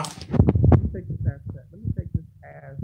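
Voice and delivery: breathy whisper, slowed; whispered aside. Real, not acted. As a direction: whisper it to yourself as you leave.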